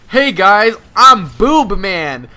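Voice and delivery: high, loud hero-style entrance; loud mock announcement. Kid in bra and wig greets class, loud announcing. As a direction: belt it out, stretching the name.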